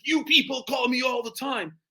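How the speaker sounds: high, loud, slow mock complaint; angry prospect. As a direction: imitate an irritated caller snapping back. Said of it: "he gets angry, he's like".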